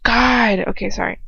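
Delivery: ordinary delivery, nothing marked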